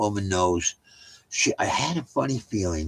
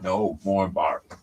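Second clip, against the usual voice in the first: No more bark drawn out slowly in a put-on voice; a slow, gentle telling-off for a barking dog; slow dog scolding; much slower than usual. Real, not acted. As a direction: stretch each word to settle the dog.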